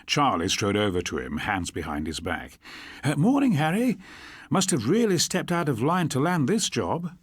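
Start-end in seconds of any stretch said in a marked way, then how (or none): 3.0-7.1 put-on higher, brighter-pitched young copper voice; cheery young constable. A narrator's reading. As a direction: play the grinning young PC, upbeat. Charlie greets Harry, character voice.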